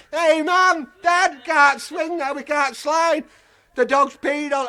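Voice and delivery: put-on high, loud child's whine; whining kids. Children complain to their parents, high whiny kid voice. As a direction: call out like a fed-up little kid.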